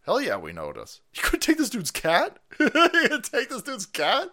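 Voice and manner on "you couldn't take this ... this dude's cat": mocking disbelief. Repeats the headline mockingly.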